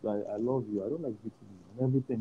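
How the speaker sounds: tender father. Retold or imitated. Father telling his son he loves him, softly. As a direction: say it softly, with love. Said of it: "My dad said those words".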